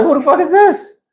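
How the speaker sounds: pitching up high in disbelief at the opponent; incredulous. Retold or imitated. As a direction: squeal the question in disbelief. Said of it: "I was like".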